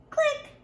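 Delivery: bright mouth click; camera click. Pretend. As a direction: snap the shutter with a click. Pretend camera shutter noise.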